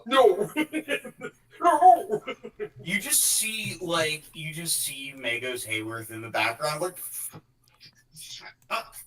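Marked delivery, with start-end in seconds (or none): 0.0-2.2 high squealed refusals, getting louder; squealing no. Real, not acted. Player teasing the table, squealing no. 8.2-9.0 unvoiced whispered shut up. Retelling a character, whispered shut up.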